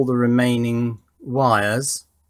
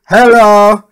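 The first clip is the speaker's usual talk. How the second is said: a sudden loud, higher "Hello!" into the mic; loud test hello; 7 semitones higher, 13 dB louder than usual. Shown as a demonstration. Testing the karaoke light board, calls out a loud hello. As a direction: call out hello to trigger the lights.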